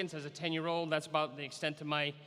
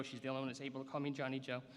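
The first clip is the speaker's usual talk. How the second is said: ordinary delivery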